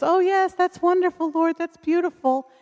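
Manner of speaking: high, gushing church lady voice; mock sweet response. Woman retelling her friend answering God, high and gushing. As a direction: gush it up high like a pleased friend. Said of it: "And she goes".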